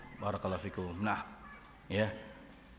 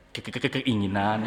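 stuttering; 5 semitones lower, 7 dB quieter than usual. Acted. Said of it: "Langsung jadi gagap".

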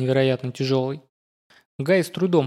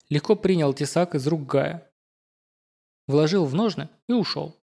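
usual speaking voice

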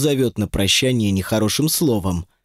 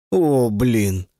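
ordinary delivery, nothing marked

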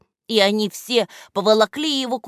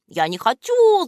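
high, louder whine; whining; 9 semitones higher, 4 dB louder than usual. A narrator's reading. Вреднюга whining to Кляксич, high and tearful. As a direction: whimper it like a sulky child. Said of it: "захныкал вреднюга".

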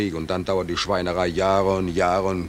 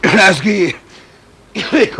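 loud, high desperate call; 9 semitones higher, 9 dB louder, slower than usual. Acted. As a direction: shout the names for help. Calling out for help. (laut rufend)

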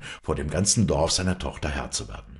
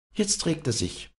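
excited call; 12 semitones higher than usual. A narrator's reading. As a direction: call the news out, pitched way up. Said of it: "rief eins der Weiber".

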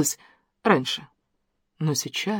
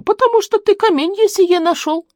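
granny voice. A narrator's reading. Granny insisting to the city man.

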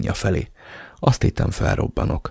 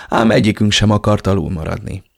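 ordinary delivery, nothing marked

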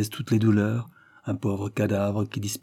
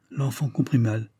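plain reading voice

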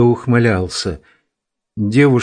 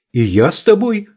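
shouted; 8 semitones higher than usual. A narrator's reading. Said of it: "крикнула Лера".

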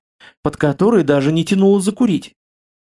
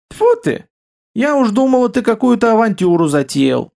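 high-pitched start, easing down in relief; relieved exclamation; 6 semitones higher than usual. A narrator's reading. Wife relieved her husband planned no adventure, relieved. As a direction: let the worry go as you say it. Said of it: "облегчённо вздохнула Илона".

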